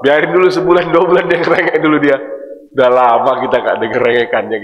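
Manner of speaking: a hint of a patient elder teasing; God mildly amused at the whining; voicing God, indulgent. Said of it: "kata Allah".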